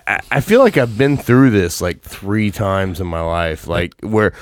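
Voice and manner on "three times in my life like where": ordinary delivery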